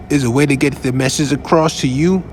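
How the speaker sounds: usual speaking voice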